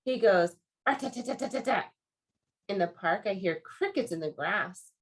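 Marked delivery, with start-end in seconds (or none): 0.9-1.9 rapid-fire tapping syllables; woodpecker hammering. A narrator's reading. Reader imitating a woodpecker for children, fast rat-a-tat. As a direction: rattle it off like a little hammer, higher. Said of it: "sounds like a little hammer".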